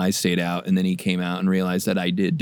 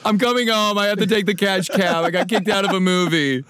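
put-on whiny kid; 8 semitones higher than usual. Kid in cash cab bit.

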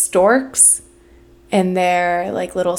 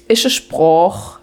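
slow, lightly voiced Alsatian dialect; drawn-out Alsatian old-timer phrase; slower than usual. Imitating an Alsatian local's saying, Alsatian dialect. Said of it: "fifty-five-year-old German man in a pub".

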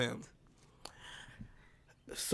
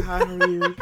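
higher, sweet flirty hello through a laugh; flirt voice; 4 semitones higher, 4 dB louder than usual. Retold or imitated. Mock flirt voice answering the staring man. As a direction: play it innocent and flirty. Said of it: "that's my flirt voice".

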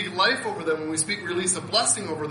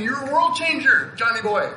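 parents' praise; 6 semitones higher, 3 dB louder than usual. Retold or imitated. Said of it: "They're saying".

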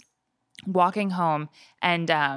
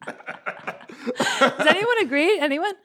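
laughing appeal; 9 semitones higher, much slower than usual. Real, not acted. Polling the room, laughing.

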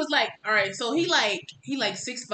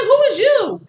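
incredulous; 13 semitones higher, 11 dB louder than usual. Retold or imitated. Retelling confronting the interviewer.

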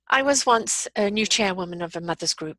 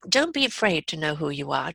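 usual speaking voice

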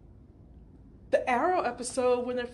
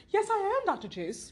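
high, sociable mock delight; cocktail-party delight; 7 semitones higher, 3 dB quieter than usual. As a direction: imitate a delighted party guest introducing herself. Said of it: "Like they're at a cocktail party".

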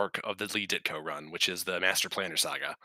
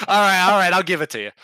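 high, loud put-on voice; imitating Sam Raimi; 9 semitones higher, 9 dB louder, faster than usual. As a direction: play the director, overeager. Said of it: "Sam Raimi was like".

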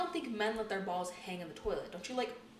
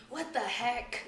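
mock British accent; 4 semitones higher, much slower than usual. Shown as a demonstration. Demonstrating clean British swearing.

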